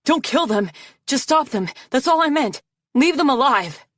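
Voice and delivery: high, frantic string of pleas. A narrator's reading. Arctic trying to undo his deadly order, frantic. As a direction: plead loudly, pitch climbing. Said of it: "shook his talons frantically".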